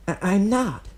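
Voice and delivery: put-on high, eager kid voice; young boy voice. A narrator's reading. Kid calling out to Obito, high boyish voice. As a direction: play the boastful kid.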